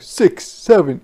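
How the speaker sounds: ordinary delivery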